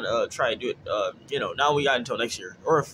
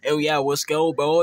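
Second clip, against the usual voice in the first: gym bro; 3 semitones higher, 7 dB louder than usual. Retold or imitated. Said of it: "instead of just, like, saying".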